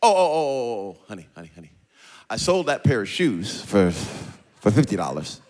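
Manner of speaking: low, lightly voiced wife explaining her shopping; mock-sweet wife reasoning; a hint of a wife talking her husband round; imitating wife. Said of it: "Onika says things to me like".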